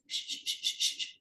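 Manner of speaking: soft repeated shushing; quiet hushing sounds. Acted. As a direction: hush everyone with shh. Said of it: "Jack said".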